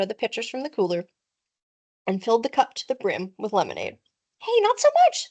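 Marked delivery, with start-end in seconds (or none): 4.4-5.3 put-on pitched-up kid's protest; boy stopping a friend's greedy pour, high; high objecting boy. A narrator's reading. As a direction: call out to stop the pour, high. Evan objecting to overfilled cup.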